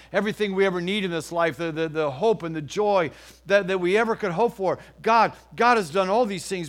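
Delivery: loud, rising preacher's proclamation; preaching at full force. Acted. Pastor preaching to his church, loud and fervent. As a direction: lift the whole room with it.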